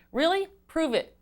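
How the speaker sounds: high, loud questioning challenge; challenging disbelief. Acted. Agent doubting what the customer said, challenging disbelief. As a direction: demand proof, pitch jumping up on the question. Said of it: "when you put a big question mark at the end".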